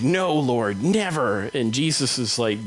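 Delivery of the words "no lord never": raised-pitch refusal on never; emphatic protest. Acted. Peter objecting to Jesus going to the cross, emphatic protest. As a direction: protest it, lifting the last word.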